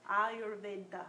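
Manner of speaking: Ayurveda said as ah-yoor-vay-da, with open ah and rolled r; correct Ayurveda. Shown as a demonstration. Teacher gives the correct Sanskrit pronunciation. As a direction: give Ayurveda its proper Sanskrit vowels. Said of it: "What should it be?".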